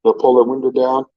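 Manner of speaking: usual speaking voice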